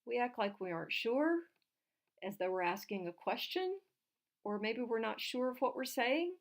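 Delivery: "sure", "question" and "saying" each rising like questions; questioning rise closing each phrase on "sure", "question", "saying"; upspeak. Shown as a demonstration. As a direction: sound unsure, lifting the pitch on "sure", "question", "saying". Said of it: "voice go up at the end".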